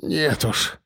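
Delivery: soft, wheezed refusal; hoarse croak. A narrator's reading. Policeman refuses Mordyukov's offer, hoarse croak. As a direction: rasp it out quietly. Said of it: "прохрипел".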